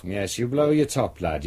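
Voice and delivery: usual speaking voice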